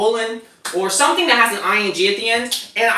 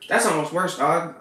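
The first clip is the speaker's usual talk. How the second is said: ordinary talk, nothing marked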